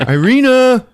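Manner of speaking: high, loud name call; acted loud, high summons. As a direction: holler for someone upstairs. Calling unanswering Irina.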